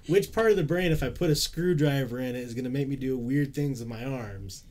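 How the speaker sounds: loud and high, a dumb guy's excited question; goofy eager question. Pretend. Friend voicing a silly question for a brain scientist, loud and eager. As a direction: blurt it out like a kid with a wild idea.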